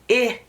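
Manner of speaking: I said as "ih"; short vowel I, as in pig; short I sound. Shown as a demonstration. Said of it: "the short sound".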